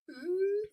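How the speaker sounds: put-on high little baby "Ooh"; imitated baby coo. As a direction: copy the baby's tiny whimper. Baby's cry imitated.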